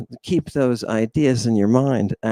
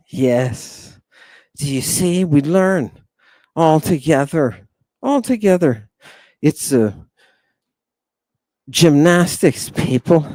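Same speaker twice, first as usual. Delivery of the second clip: ordinary delivery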